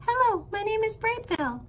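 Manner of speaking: high, chirpy small-bird greeting; gosling introduces himself to anyone, high and chirpy; little gosling voice. Acted. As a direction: greet everyone like a tiny gosling. Gosling voice in story.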